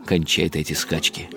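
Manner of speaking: ordinary delivery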